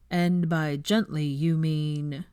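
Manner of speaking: slow, doubtful probing question; suspicious Neville. Acted. Neville questioning Hermione, slow and doubtful. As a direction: draw it out, sceptical.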